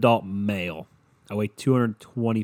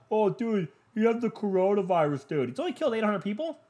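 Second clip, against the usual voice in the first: mimicking people; 10 semitones higher than usual. Mimicking people talking about coronavirus.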